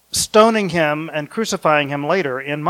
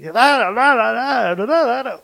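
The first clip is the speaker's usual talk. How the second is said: higher, hammed-up car salesman shouting nonsense; mock car ad yelling; 7 semitones higher than usual. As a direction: imitate a pushy TV car ad announcer, pitched up. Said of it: "the person is yelling".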